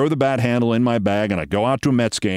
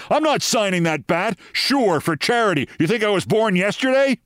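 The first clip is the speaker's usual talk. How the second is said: retold angry outburst; 8 semitones higher than usual. Ballplayer refuses to sign the bat, mock indignant and higher. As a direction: imitate an angry refusal, raised pitch only. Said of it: "and he erupts at me".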